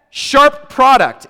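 loud, slow, spelled-out warning; read in all caps. Retold or imitated. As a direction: bark it like a warning label in capitals. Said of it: "in all caps".

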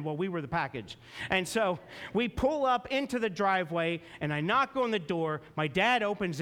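usual speaking voice

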